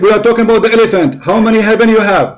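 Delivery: host fed up with the guest dodging his question; heated frustration. Real, not acted. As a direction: snap back at the opponent, fed up. Host presses his demand.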